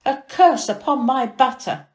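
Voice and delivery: despairing cry. A narrator's reading. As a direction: lament it to the sky. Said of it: "he cried out in despair".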